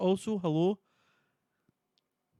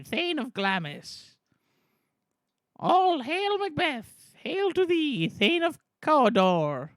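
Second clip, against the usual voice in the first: high, slow, drawn-out witch proclamations; hailing witches; 4 semitones higher, 5 dB louder, slower than usual. Acted. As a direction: hail him grandly, stretching the names.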